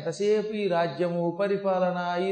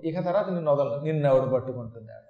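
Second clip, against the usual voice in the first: ordinary talk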